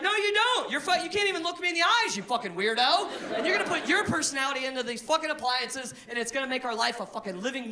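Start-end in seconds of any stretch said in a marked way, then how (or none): 0.0-3.1 high, loud, fast put-down; mock rebuttal. Comic telling off an incel programmer, high mock scorn. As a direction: snap back at the nerd. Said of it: "You're like".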